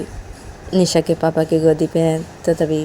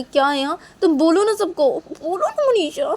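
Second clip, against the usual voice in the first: Nisha's insistent pleading; 11 semitones higher than usual. Acted. Nisha pushes Manisha.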